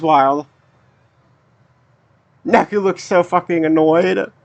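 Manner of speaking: low, even voice reading the characters' lines; reading game dialogue. Acted. Reads game dialogue aloud.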